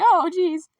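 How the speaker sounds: mild mock dismay; Alice. Alice reacts after dropping the money in, high mock dismay. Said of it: "She's like".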